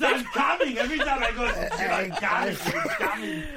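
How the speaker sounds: high laughing mock voice; laughing imitation. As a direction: mimic him while cracking up. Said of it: "It's like, what the fuck?".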